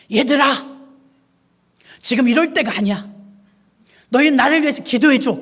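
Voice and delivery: put-on a trapped girl begging the kids to pray; urgent little girl. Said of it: "그러더랍니다". (책상에 낀 딸이 친구들에게 다급하게 부탁)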